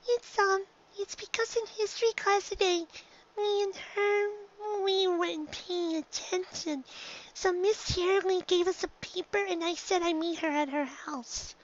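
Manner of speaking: high filly voice, halting and sheepish; a filly owning up; young filly Dinky, embarrassed. A narrator's reading. Filly confessing to her mother, high and sheepish.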